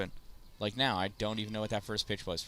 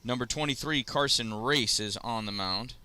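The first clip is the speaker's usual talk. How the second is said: announcer intro; 3 semitones higher than usual. Acted. Announces pitcher and leadoff batter.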